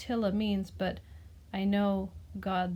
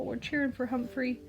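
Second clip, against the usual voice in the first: ordinary delivery, nothing marked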